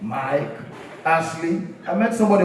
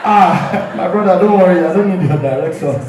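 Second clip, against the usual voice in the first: laughing dismissal; 6 dB louder than usual. Acted. African man refusing directions.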